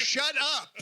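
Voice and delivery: high-pitched mock exasperation; playful fed-up outburst; exasperated outburst. Fed up with clichéd narration.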